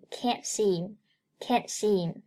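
"can't seem" with no t sounded in "can't"; silent t. Shown as a demonstration. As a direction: slowly join "can't seem" as "can-seem". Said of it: "同样can't不发t那个音".

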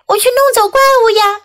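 high, quick mock shout; yelling back. A narrator's reading. Child explains to mom about the monster, mock yelling back. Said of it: "我喊回去".

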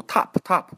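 "top" said like "tahp", open ah; ah sound. Shown as a demonstration. Teacher shows what listeners mishear, "top" with ah sound. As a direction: turn the o of "top" into ah. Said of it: "那个啊的音".

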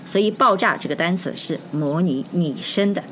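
ordinary talk

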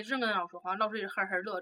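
normal talking voice

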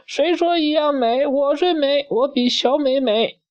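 very high, bratty furious squeal; squealing in a rage. Acted. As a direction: shriek it like a spoiled girl in a tantrum. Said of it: "大美气得哇哇叫".

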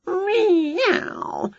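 high, drawn-out mew; cat meow. A narrator's reading. Wilbur grinning for his photo, meowing. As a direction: imitate a cat, gliding down.

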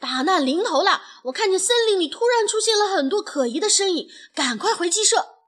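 high, loud, rushed warning; panicked shouting. A narrator's reading. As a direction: raise the alarm, frantic, words tumbling out. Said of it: "惊慌地大喊道". (惊慌失措地高声报警)

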